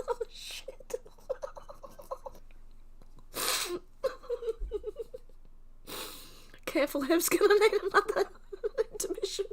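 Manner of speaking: high voice breaking up with laughter; real uncontrollable giggles; helpless laughter. Real, not acted. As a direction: squeeze the words out through a laughing fit. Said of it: "I've gotta get a ho-, hold of myself".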